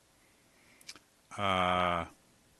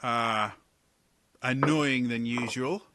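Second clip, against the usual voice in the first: rough pirate captain; 4 semitones higher, slower than usual. Acted. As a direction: rib an old friend boisterously. Pirate captain greets the party.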